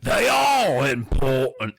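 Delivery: slow, booming British character voice; large British man. Acted. Caped man bellowing, as a large British man. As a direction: play a big British man, drawing words out. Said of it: "I'm gonna do a large British man".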